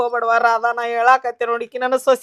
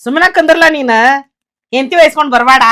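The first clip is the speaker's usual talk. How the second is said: loud, sharp scolding of a grown son; furious mother-in-law shouting at her son; angry scolding; 9 dB louder than usual. Acted. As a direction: tell him off at full volume.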